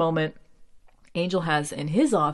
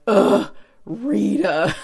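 drawn-out annoyed "ugh"; exasperated groan; 3 semitones higher, 7 dB louder, much slower than usual. Retold or imitated. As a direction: groan it slowly, fed up. Mom groaning at the actress.

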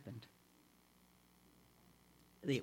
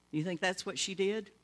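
usual speaking voice

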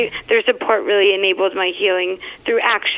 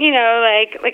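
ordinary talk, nothing marked

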